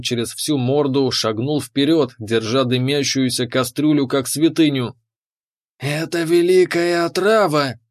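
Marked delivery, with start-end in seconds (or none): 5.8-7.8 lizard warrior in higher-pitched awe; mildly hissed respect; reverent hiss. A narrator's reading. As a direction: admire it as a lizard fighter. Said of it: "прошипел он с уважением".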